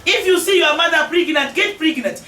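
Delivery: ordinary talk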